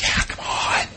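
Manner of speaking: breathless whispered lure; Satan whispering. Acted. Satan luring the buyer, whispered. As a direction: whisper it like a tempter. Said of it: "And Satan's like".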